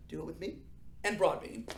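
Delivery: usual speaking voice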